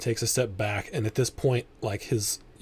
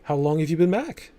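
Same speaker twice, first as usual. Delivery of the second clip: higher-pitched, tentative small-talk voice; woman easing tension; 6 semitones higher, 3 dB louder than usual. Acted. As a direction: lift the pitch to break an awkward silence. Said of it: "cut the tension a little bit".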